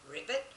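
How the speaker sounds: frog ribbit. Shown as a demonstration. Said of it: "Now you can sing it with me".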